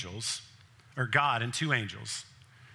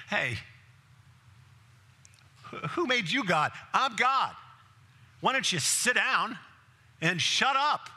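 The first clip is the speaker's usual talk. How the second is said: mock rebuke; 8 semitones higher than usual. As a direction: bark it like an offended boss. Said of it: "Did he say, what".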